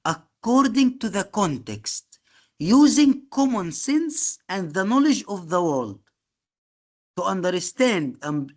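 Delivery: reading a definition aloud slowly; slow, word by word, as if dictating to students; teacher slowly reading a key definition to the class. Shown as a demonstration. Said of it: "ودي مهمة بس نقراها".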